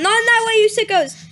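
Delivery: high, loud jab at the audience; mock scolding shout. Real, not acted. Reader turns to scold the chat, loud and mocking. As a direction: snap it at the sickos.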